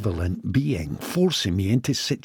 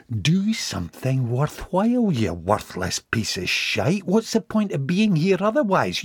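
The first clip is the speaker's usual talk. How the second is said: goading inner voice; 7 semitones higher than usual. Acted. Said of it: "the voice goading him".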